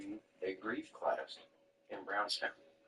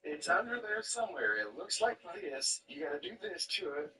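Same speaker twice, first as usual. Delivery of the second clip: higher, mock-yelling uncle voice; imitating Uncle Rick; 9 semitones higher than usual. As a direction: play the uncle barking instructions up high. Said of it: "I heard Uncle Rick yelling at him".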